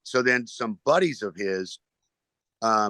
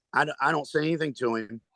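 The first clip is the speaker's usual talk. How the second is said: ordinary talk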